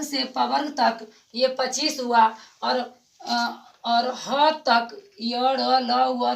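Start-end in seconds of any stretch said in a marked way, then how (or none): 2.6-6.3 slow listing. Shown as a demonstration. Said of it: "teacher lists consonants".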